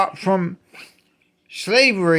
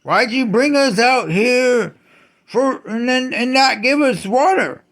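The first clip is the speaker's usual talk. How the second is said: higher, whiny cry of the thirsty people; mock complaining crowd; 6 semitones higher than usual. Ranter mimics the people crying out in the desert, higher. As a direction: pitch it up like desperate complainers. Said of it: "started crying out in the wilderness".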